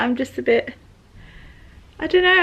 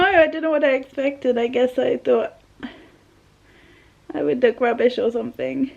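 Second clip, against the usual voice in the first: ordinary delivery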